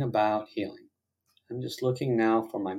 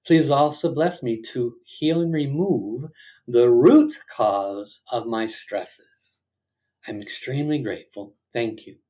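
prayer; 3 semitones higher, 4 dB louder than usual. Acted. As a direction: lead the group in prayer. Congregation repeats a prayer.

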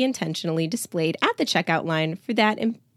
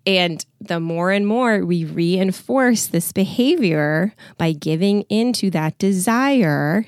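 usual speaking voice

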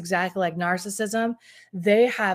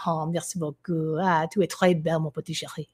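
low, flirtatious French; playful French accent; 3 semitones lower than usual. Pretend. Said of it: "You love my French accent?".

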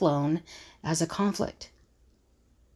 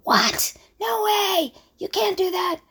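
much higher, shocked "No way!"; shocked outburst; 12 semitones higher, 3 dB louder, slower than usual. Retold or imitated. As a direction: recoil in disbelief, loud and high. Shocked reaction to Playboy idea.

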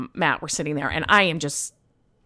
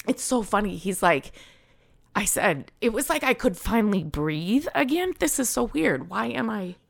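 real tearful voice rising higher; choked up; 3 semitones higher than usual. Real, not acted. Patient telling how she could finally breathe, tearfully choked up. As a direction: struggle to keep talking as tears come. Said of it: "I'm not supposed to get choked up".

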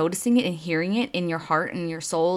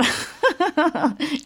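high-pitched burst of laughter; amused laugh; 10 semitones higher than usual. Real, not acted. Laughing at her own story.

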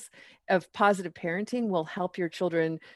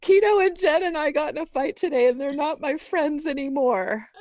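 voicing a tearful daughter, higher and louder; a hint of a crying ten-year-old; daughter's upset voice; 8 semitones higher, 4 dB louder than usual. Mother imitating her daughter's upset confession, higher. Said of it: "she says".

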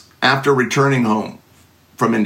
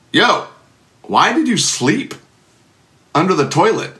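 a higher, lightly voiced baffled wife; puzzled wife; 5 semitones higher than usual. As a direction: poke him awake, half amused. Wife finding him on floor.

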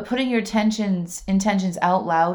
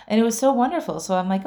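ordinary delivery

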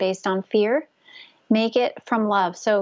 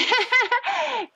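high nervous laugh. Acted. Awkward letter, acted laugh.

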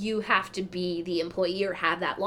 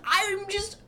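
usual speaking voice

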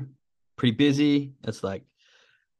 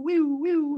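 high wailing whew, whew of an ambulance siren; siren imitation. Telling of traffic, imitating the passing ambulance siren. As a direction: swoop your voice up like a siren.

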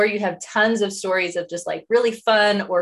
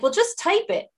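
put-on older kid nudging; 11 semitones higher than usual. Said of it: "they'll be like".

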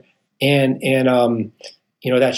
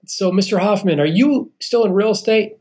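higher, polite female phone agent; a higher voice for the woman calling about his credit; bank lady voice; 7 semitones higher than usual. Retold or imitated. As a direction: play the bank clerk asking questions. Bank woman questions him on phone.

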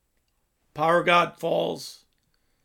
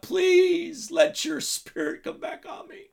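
acted cry for forgiveness, fading at the end; 9 semitones higher than usual. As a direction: plead as if imitating a desperate prayer. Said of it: "he's crying out to God".

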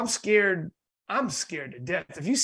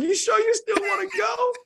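high-pitched, incredulous check with niece; mock alarmed question. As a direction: retell it in a squeaky, nervous voice. Asking niece after the scare.

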